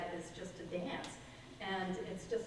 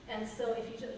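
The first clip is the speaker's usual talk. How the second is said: normal talking voice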